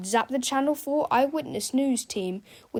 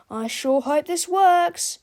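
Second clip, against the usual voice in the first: nervous hope; 7 semitones higher, 5 dB louder, slower than usual. Acted. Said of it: "said Harold".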